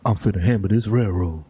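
deep, stoic railroad man; John Henry. Pretend. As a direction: drop low and declare it like a film hero. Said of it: "And he's just like".

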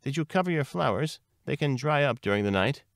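high-pitched fretful worry; agitated. A narrator's reading. Neurosis frets to Lily Bunny about flowers, agitated. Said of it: "difficulty controlling its agitation".

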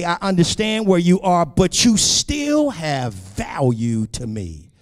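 fervent, emphatic sermon delivery; impassioned preaching. Real, not acted. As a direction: preach it straight at the congregation.

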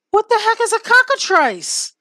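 very high, bewildered panic; baffled terror; scared horror game streamer. Real, not acted. Baffled, frightened at the creature.

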